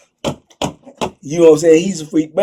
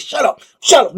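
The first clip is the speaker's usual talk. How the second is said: higher, sharp shut up; hissed order; 8 semitones higher, 5 dB louder than usual. Retold or imitated. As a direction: cut him off fast. Inmate silencing victim.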